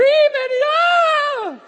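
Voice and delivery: piercing high shouted ah; startled scream. Retold or imitated. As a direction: scream like someone is stabbed. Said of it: "he's screaming".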